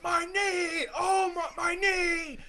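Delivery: high, loud mock wail of pain; imitated screaming. Acting out his friend hurt in the water, screaming. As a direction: yell it like you've hurt yourself. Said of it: "He's screaming, yelling".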